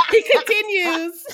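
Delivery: laughing through the words; barely holding in laughter. Real, not acted. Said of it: "crowd was silent".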